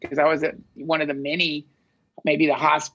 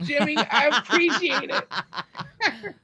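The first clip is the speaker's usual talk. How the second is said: much higher, laughing through the words; laughing sign-off; 12 semitones higher than usual. Real, not acted. Signing off to Jimmy, much higher and laughing. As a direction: giggle out a mock-dazed farewell.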